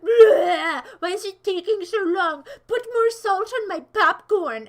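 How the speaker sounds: mock crying customer voice, loud and whiny. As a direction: whine like a greedy popcorn customer. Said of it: "They're always like".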